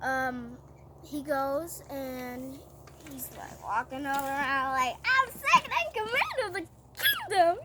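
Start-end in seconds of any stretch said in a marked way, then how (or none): 5.0-7.6 high-pitched, hammed-up bragging; boastful strutting official. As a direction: crow it like a puffed-up showoff. Haman struts around boasting.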